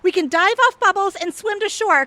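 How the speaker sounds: ordinary delivery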